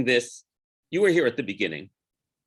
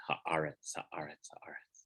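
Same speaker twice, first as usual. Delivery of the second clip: each haaretz softer, dying away to a breath; fading echo; 7 semitones lower, 14 dB quieter than usual. Shown as a demonstration. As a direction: let haaretz fade out like an echo. Repeats word, fading to nothing.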